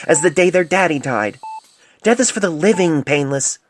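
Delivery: impassioned rebuke. Acted. Senior doctor scolding her junior over a dying patient, fierce and loud. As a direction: let it build into a fierce stand. Poultice lectures Painless on death.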